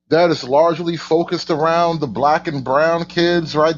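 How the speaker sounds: normal talking voice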